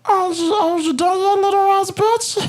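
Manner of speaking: very high coaxing inner voice; high calming voice. Retold or imitated. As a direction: soothe yourself in a squeaky high voice. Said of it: "this is my voice that I use to calm myself down".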